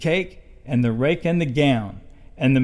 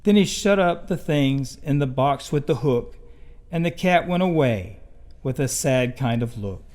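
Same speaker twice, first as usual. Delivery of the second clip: ordinary delivery, nothing marked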